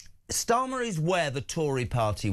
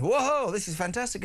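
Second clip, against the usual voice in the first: higher-pitched parody of a thrilled reaction; mock excited crowd; 5 semitones higher than usual. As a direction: imitate everyone whooping with delight. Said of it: "And everybody went".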